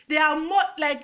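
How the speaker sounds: normal talking voice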